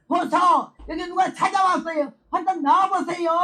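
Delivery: high yelled summons; shouting for husband. Retold or imitated. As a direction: call him out so he's sure to hear. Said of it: "근데 왜 이렇게 소리 질러?".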